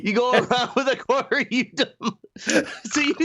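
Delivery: high-pitched, words broken up by laughter; laughing while talking. Real, not acted. Co-host mocks the prankster, laughing while talking. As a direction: tease him through a fit of laughter. Cracking up at co-host's story.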